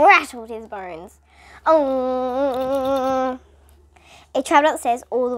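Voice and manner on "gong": gong. A narrator's reading. Gong sound imitated.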